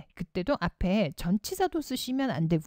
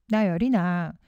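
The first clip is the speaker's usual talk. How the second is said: ordinary talk, nothing marked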